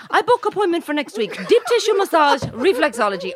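high mimicked sales pitch; masseuse. Masseuse booking client for next week, high imitation. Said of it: "And she goes".

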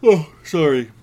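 talking through a yawn. Real, not acted. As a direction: say it mid-yawn. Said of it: "I'm yawning".

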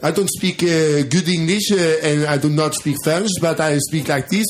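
mock Frenchman's English with a French accent. Shown as a demonstration. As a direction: lean into a halting French accent. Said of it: "prata engelska med fransk brytning".

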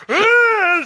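loud, high put-on yawn; exaggerated fake yawn to cover odd behaviour; mock yawn. Husband hiding a rat from his wife, hammed-up yawn.

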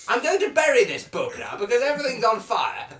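loud, fast panicked rush. Acted. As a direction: blurt it out in a panic. Panic as fire spreads.

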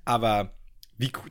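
ordinary talk

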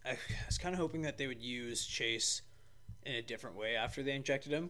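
normal talking voice

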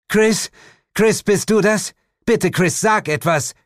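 high, loud pleading calls; desperate calling. Acted. Woman searching the store for Chris, loud pleading calls. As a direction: call out for him, close to panic.

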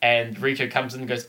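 ordinary talk, nothing marked

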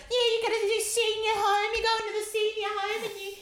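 high, loud singsong mockery; singsong taunt. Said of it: "she was like".

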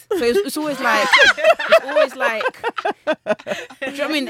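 talking through high, loud laughter; slow, helpless laughing, struggling to get words out; real laughing. Real, not acted. Telling dad story to hosts, real helpless laughter.

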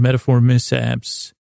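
normal talking voice

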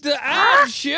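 high silly announcer voice; Mister Ads. Acted. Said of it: "it's me, Mister Ads".

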